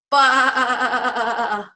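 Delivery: loud, long wavering baa; quavering drawn-out sheep noise; sheep bleat. Shown as a demonstration. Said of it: "What does the sheep say?".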